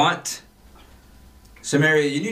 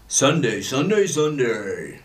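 booming Sunday Sunday Sunday echo; monster truck ad; 2 semitones higher, slower than usual. Pretend. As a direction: hype it like a truck rally ad. Echoing ad announcer voice.

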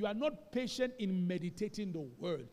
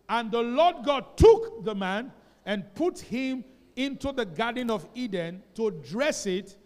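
loud, slow, measured recitation of scripture; scripture reading; 3 semitones higher, 10 dB louder, slower than usual. Real, not acted. Pastor leading the church in reading Genesis, loud and slow. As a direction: lead the whole church through the verse.

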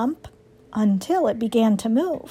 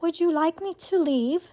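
higher, softer robot voice; soft, lightly voiced robot; 5 semitones higher, 5 dB quieter than usual. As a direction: answer quietly and evenly as a robot. Robot asks the wounded fox.

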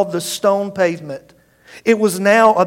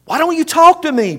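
much higher, louder demand; 11 semitones higher, 5 dB louder than usual. Acted. Pilate to the silent Jesus, loud angry demand. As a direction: bark it at the silent prisoner. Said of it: "Pilate demanded".

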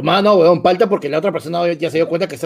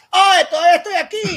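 put-on squeaky girlfriend voice; 13 semitones higher, 5 dB louder than usual. Friend's girlfriend voiced on phone.

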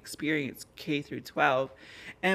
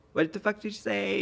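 sharp, higher challenge thrown back at the bullies; retold confrontation; 6 semitones higher than usual. To the bullies who called him a slur, a mock furious challenge. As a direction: spin around and call them out, half acted.

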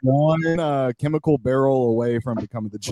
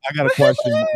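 mock high voice; 3 dB louder, faster than usual. Teasing high voice to John.

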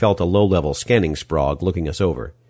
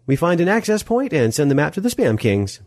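Molly, ignoring him; 11 semitones higher than usual. Acted. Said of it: "pretending as if I had said nothing at all".

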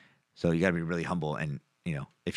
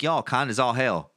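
put-on mad Southern old lady; 5 semitones higher, 4 dB louder than usual. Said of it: "I didn't know she was mad".

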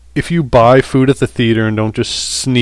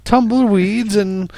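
high, slow would-be Texan; failed Texan accent; 9 semitones higher, 3 dB quieter than usual. Pretend. Co-host showing off his Texan accent, comically wrong. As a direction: attempt Texan and land in British. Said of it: "That's my Texan accent".

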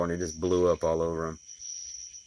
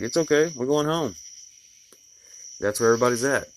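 put-on higher, gentle reassurance to a small child; soothing daughter; 6 semitones higher, 3 dB louder than usual. Comforting crying daughter on drive.

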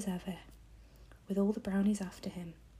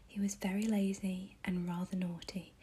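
usual speaking voice

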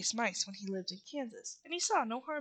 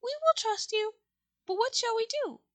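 high, raised little mouse voice; regal mouse queen; 11 semitones higher, 5 dB louder than usual. A narrator's reading. Queen agrees to help the Scarecrow, high mouse voice. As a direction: speak as a tiny queen ready to help.

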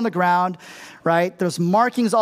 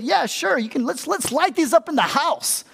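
much higher, breezy mock permission; a lax parent giving in to the kids, breezy and higher; careless yes; 8 semitones higher than usual. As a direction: sell it as a clueless pushover parent.

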